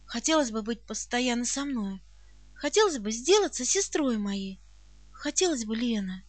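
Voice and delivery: low, soft, earnest offer; very serious. A narrator's reading. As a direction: ask gravely and tenderly. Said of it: "спросила очень серьёзным тоном". (серьёзно, тихо и проникновенно)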